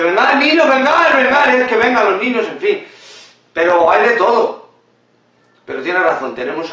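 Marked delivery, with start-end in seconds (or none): 0.0-2.5 put-on much higher, louder call to the kids; a caricature mother hurrying her children along; hammed-up shout calling the children over; mock mother calling. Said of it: "luego dice".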